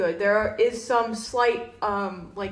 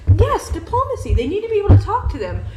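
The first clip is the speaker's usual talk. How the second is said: laughing; 9 semitones higher, 9 dB louder than usual. Real, not acted. Laughing agreement.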